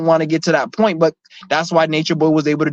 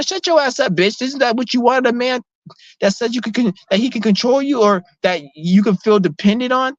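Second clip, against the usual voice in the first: much higher, scornful scolding; indignant outburst; 7 semitones higher than usual. Real, not acted. Ranting at women who call men narcissists, much higher and scornful. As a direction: snap at them in disbelief.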